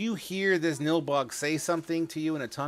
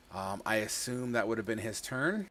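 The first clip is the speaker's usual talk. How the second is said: ordinary talk, nothing marked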